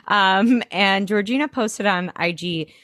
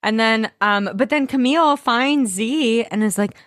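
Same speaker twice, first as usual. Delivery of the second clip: usual speaking voice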